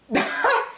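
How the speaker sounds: short high squeal of laughter; high giggle. Real, not acted. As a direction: let a high giggle escape. Still giggling at the movie.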